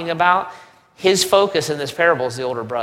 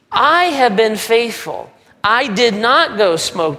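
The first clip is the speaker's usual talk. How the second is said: self-righteous brother; 5 semitones higher, 4 dB louder than usual. Acted. Brother boasting faithfulness.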